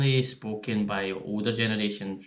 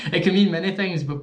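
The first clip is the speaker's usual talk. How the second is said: laughing out loud, higher and louder; cracking up while talking; real laughter; 9 semitones higher, 6 dB louder than usual. Real, not acted. Laughing while explaining the word boggin to friends.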